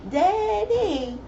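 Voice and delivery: put-on high sing-song little girl voice; baby voice. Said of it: "she gets this little baby voice".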